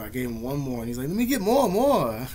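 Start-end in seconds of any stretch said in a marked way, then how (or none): 1.1-2.3 high, pushy mock whine of the bully; greedy whine. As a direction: beg for more, hamming up the greed. Greedy bully begging for cigarettes.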